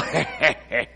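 low, soft mock-evil chuckle; sarcastic evil laugh. Preacher showing laughter that doesn't agree, a sarcastic snicker. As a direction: snicker quietly with put-on sarcasm. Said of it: "it's just this sarcastic, evil".

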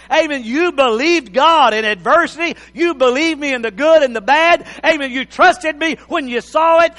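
loud, fervent preaching; booming motivational speech. Acted. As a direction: preach it out to the congregation. Preaching at full force.

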